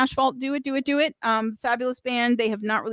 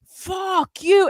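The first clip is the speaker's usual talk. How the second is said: high, shouted fuck you; playful mock yell; 9 semitones higher, 3 dB louder than usual. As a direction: yell it like a band clip. Imagined bands shouting.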